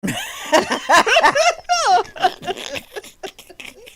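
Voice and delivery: loud high burst of laughter; big laugh. Real, not acted. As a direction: shriek with laughter at the excuse. Friend's early-morning excuse.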